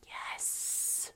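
breathy secret yes; whispering. Pretend. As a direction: whisper back in agreement. Whispered agreement to secret idea.

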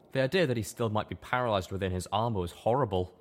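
usual speaking voice

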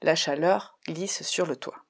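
slow, paced over three steps. Shown as a demonstration. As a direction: say it across three even steps. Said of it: "en trois pas".

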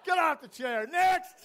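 high, laughing, gleeful orders; loving every haircut; gleeful barber. Retold or imitated. Iraqi barber enjoying himself, high gleeful calls of next. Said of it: "he goes".

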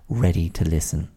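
ordinary delivery

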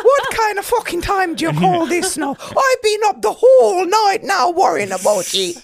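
high, loud Irish scolding sliding into Jamaican; badly faked Irish, drifting towards Jamaican; attempted Irish accent. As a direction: rant in a wobbly mock-Irish accent. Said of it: "now it's going into the Jamaican".

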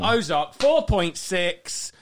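high, very slow score reveal; slow drawn-out reveal. Acted. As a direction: stretch every word like a verdict. Repeating the score for effect.

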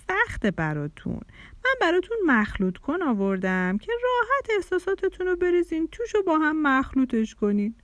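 put-on peddler's pitch; vendor voice. A narrator's reading. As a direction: explain the gadget like a street seller. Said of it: "با همون صدا".

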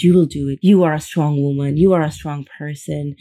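usual speaking voice

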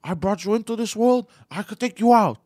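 high, loud mock threat; imitated aggressive yelling. Retelling how he was pushed and threatened, mock aggressive yell. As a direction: puff up and shout it like an outraged relative. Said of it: "Yeah, like this".

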